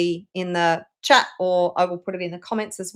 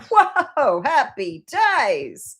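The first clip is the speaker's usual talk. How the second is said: mock excitement; 4 semitones higher, 3 dB louder, much slower than usual. Mock celebrating sudden inheritance.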